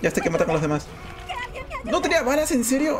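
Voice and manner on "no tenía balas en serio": high-pitched incredulous questions; real disbelief. Real, not acted. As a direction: blurt it out in startled disbelief. Reacts to empty gun in game.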